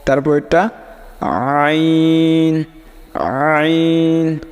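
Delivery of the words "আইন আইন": আইন squeezed from deep in the throat, drawn out; deep-throat ain. Shown as a demonstration. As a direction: stretch আইন from the bottom of the throat. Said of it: "আইন কিন্তু বলার সময় গলার". (গলার শেষ ভাগ থেকে টানা আইন)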